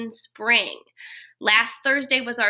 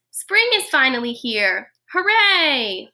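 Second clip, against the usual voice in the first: small mouse shouting hooray with joy; cheering mouse; 3 semitones higher, 4 dB louder than usual. Acted. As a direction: cheer it like a thrilled little mouse.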